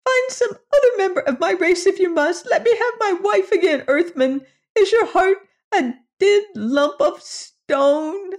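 very high, desperate plea; a tiny creature imploring the Earthman; begging little alien. Acted. Alien begging for his wife.

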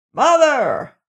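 high shouted call to mother; calling through the house; shouting. A narrator's reading. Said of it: "he shouted".

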